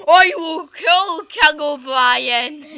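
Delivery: loud slow laughing threat; laughing battle cry. Pretend. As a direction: shout a silly threat.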